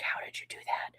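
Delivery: soft whispered question; hushed daughter quote. Retold or imitated. Retelling the daughter's question about the bandaged fingers, whispered. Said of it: "'cause she's like".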